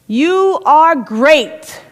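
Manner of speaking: high, very slow ringing call. Acted. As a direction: stretch every word out across the valley. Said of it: "yells back the mountain".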